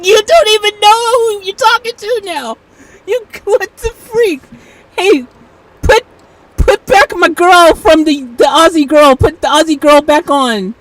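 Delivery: high, loud incredulous outburst; loud, high-pitched worked-up demand. Real, not acted. Demanding the Aussie voice back.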